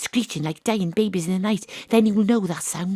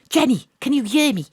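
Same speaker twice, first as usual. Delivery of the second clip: higher and louder, pushing through a bad line; shouting down the phone; 5 semitones higher, 5 dB louder than usual. [name] calls Peter and Jenny from the quarry, shouting down the phone. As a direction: make yourself heard over the static.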